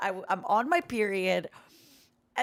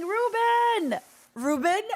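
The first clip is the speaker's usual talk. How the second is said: high, drawn-out call of a name; excited greeting; 11 semitones higher, 3 dB louder, slower than usual. Real, not acted. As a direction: cry out the name in delighted greeting.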